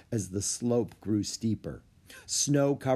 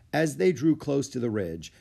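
plain reading voice

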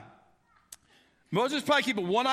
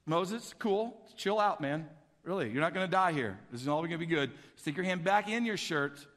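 low, laid-back soothing; easygoing "relax" tone, low and unworried; calm reassurance; 5 semitones lower than usual. Acted. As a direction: talk someone down coolly, voice dropped. God calms a panicking Moses.

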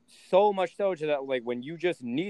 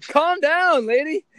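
higher, louder mock calm down; exasperated; 12 semitones higher, 8 dB louder, slower than usual. Son telling his mom to calm down, much higher and louder. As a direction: snap at her to calm down, hammed up. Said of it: "And I'm like".

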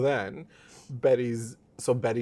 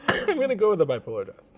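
higher, louder mocking voice; mock Cheryl; 8 semitones higher, 5 dB louder than usual. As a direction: ham up her decision. Mocking Cheryl's dress choice.

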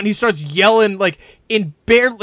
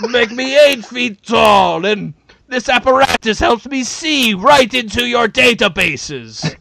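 mock villain boast; slower than usual. Imagining the tall player boasting to the crowd, slow booming mock villain. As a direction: ham it up like a Soviet-built supervillain. Voicing the eight-foot player.

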